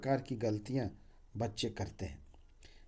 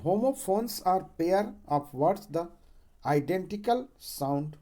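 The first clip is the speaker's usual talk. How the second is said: slow dictation; 2 semitones higher, much slower than usual. Acted. Dictates definition slowly.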